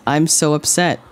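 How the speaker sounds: usual speaking voice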